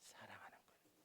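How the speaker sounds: hushed whispered answer. Real, not acted. Preacher answering his own question, hushed whisper. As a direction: drop to a whisper to land it.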